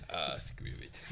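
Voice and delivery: low whispered croak; parody lizard voice. Retold or imitated. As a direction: rasp it like a lizard man. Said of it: "Game Grumps remake where they parodied".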